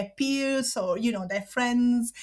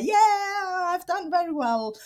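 a burst of pride, pitched higher; happy to share; 9 semitones higher than usual. Retold or imitated. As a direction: announce the good news brightly. Sharing school joy with parents.

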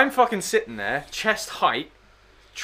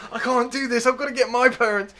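imitated panic; 7 semitones higher than usual. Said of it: "this guy on the end starts panicking".

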